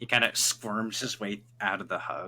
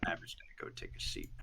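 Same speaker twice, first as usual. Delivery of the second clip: muttered aside; 13 dB quieter than usual. Acted. Low unvoiced mutter.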